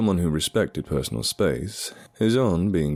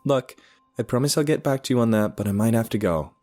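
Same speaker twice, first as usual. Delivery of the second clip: young woman, lightly voiced higher; hurried apologetic girl, voiced higher; Scarlett's voice; 8 semitones higher than usual.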